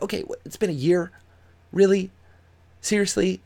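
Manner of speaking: high, disbelieving questions; incredulous. Real, not acted. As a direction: scoff in disbelief. Incredulous at the news story.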